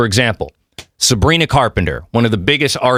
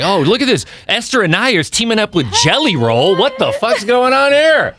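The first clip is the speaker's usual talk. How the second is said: imagined listener; 9 semitones higher than usual. Retold or imitated. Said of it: "where it's like".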